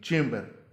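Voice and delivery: चेम्बर as CHAYM-ber; long "ay" in चेम्बर, to be remembered; correct pronunciation. Shown as a demonstration. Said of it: "याद रखिए". (चेम्बर, लंबे "ए" के साथ)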